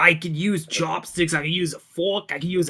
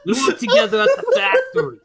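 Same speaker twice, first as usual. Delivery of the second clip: excited high laughing; 13 semitones higher, 6 dB louder than usual. Acted. Eldritch lady gushes about coworker.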